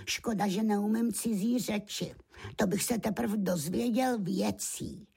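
high-pitched wistful lamb voice; sad little lamb. A narrator's reading. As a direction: lament it high and plaintively. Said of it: "a smutně si říkal". (smutný beránčí hlásek, vysoko)

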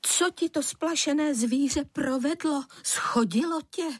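high, startled questioning; alarmed mother. A narrator's reading. Mother rushing out to her fallen son, alarmed. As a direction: raise the pitch in motherly alarm. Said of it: "polekala se a rychle vyběhla ven".

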